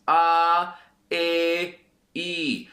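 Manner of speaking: "A, E, I" sung out open and voiced, the first loud; voiced Spanish vowels. Shown as a demonstration. Teacher contrasting Spanish and English sounds, open vowels. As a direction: show how Spanish vowels come from the throat. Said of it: "All of the sounds in Spanish will come out".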